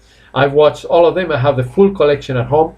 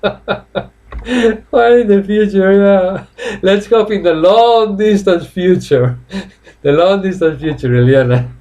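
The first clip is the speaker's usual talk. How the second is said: laughing tease; 6 semitones higher than usual. Real, not acted. Teasing a viewer, laughing.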